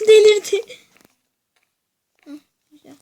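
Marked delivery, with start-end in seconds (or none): 0.1-0.8 very loud high scream. Real, not acted. Screamed exclamation.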